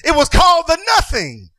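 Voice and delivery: proclaiming the name; preacher exclaims. Acted. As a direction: hit it hard.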